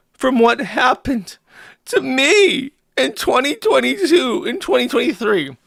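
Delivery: hammed-up weepy reading; mock sobbing. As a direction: whimper it out like a hammed-up pity party. Mock-reading a self-pitying post.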